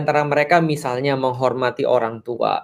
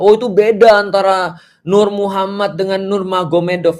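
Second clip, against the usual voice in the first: mock objection; 5 semitones higher, 4 dB louder than usual. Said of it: "Kan kita tidak mengatakan tadi".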